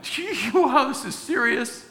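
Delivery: mock wide-eyed shock; startled, half-laughing wow; amazed. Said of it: "And I'm like".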